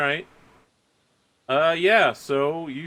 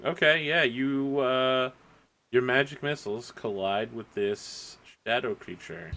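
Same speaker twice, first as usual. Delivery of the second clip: normal talking voice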